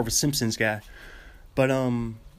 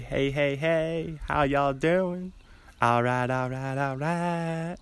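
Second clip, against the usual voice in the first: slow, drawn-out Texan drawl; Matthew McConaughey; much slower than usual. Acted. Fan mimicking an idol who lives in Austin, drawling impression. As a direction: stretch out a laid-back impression. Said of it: "Matthew McConaughey".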